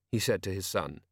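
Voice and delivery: ordinary delivery, nothing marked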